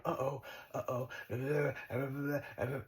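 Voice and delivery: slow, hammed-up fretful uh-ohs; mock nervous stammer; mock worry. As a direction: play a jittery worrier, half-hearted. Mocking a worried mind.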